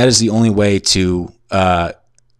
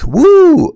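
sudden high, loud "woo"; high imitated whoop; 7 dB louder than usual. Telling what came through the roommate's wall, a loud high imitated whoop. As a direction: mimic an overheard whoop, held and high. Imitates overheard sound in story.

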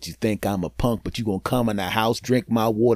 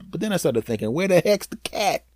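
ordinary talk

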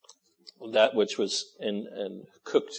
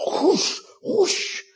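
mock sword whoosh; 5 dB louder than usual. Imitating a broadsword swing.

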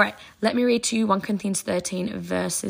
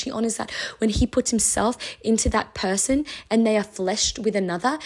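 ordinary talk

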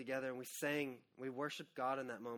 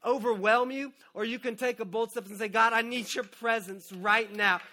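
loud, higher, driving sermon; fervent preaching; 5 semitones higher, 9 dB louder than usual. Acted. Pastor urging the congregation to worship, loud and fervent. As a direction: preach it with rising fire.

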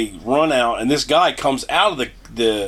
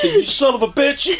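higher jokey cursing; hammed-up insult; mock anger; 12 semitones higher than usual. Cursing the trucker in jest, higher mock anger.

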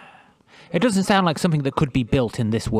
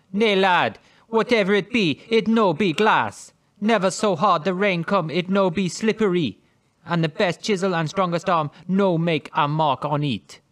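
put-on higher, louder Jamaican accent; 5 semitones higher, 5 dB louder than usual. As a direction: read it in a hammy Jamaican accent. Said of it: "hearing that accent".

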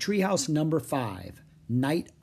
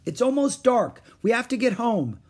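high, raised worried call; loud, high warning to come back; 10 semitones higher, 5 dB louder than usual. A narrator's reading. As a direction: call after her with urgency. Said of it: "Jack called".

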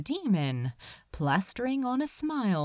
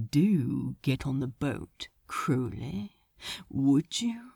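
sneering demon; 7 semitones lower than usual. Acted. Asmodeus mocking the pair, low and sneering. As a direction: purr it with mock politeness.

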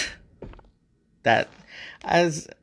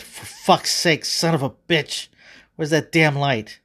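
loud, higher outburst of pain; exasperated cursing; 3 semitones higher, 3 dB louder than usual. Acted. God cursing after stubbing his toe in darkness, exasperated outburst. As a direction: swear it out hard, stretching the first words.

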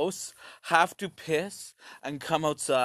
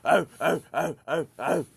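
caveman grunts; 3 semitones higher than usual. Retold or imitated. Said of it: "we all have a little primal in us".

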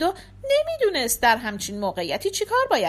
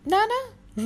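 plain reading voice

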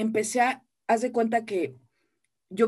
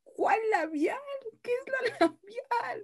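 squeaky bewildered questions, much higher; panicked confusion; slower than usual. Retold or imitated. A new actress baffled by her director's jargon. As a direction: sound lost and desperate, voice climbing. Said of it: "Yo decía".

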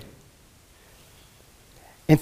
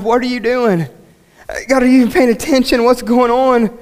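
pouring out heart; 8 semitones higher than usual. Acted. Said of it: "He pours his heart out to God".

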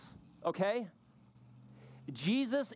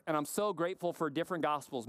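normal talking voice

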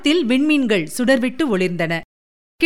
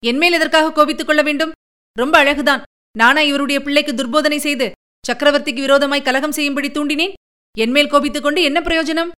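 higher and fast, hotly offended; indignant protest; 4 semitones higher, faster than usual. Acted. Kundavi protesting to her father that the queen blames her, fast and indignant. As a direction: fire back in sharp indignation.

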